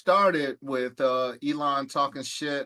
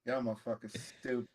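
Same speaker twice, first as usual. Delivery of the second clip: whispered aside; 5 semitones lower, 12 dB quieter, faster than usual. Real, not acted.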